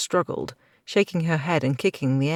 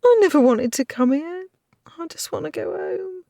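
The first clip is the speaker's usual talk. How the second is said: put-on sobbing girl; 5 dB louder than usual. A narrator's reading. Girl crying to go home.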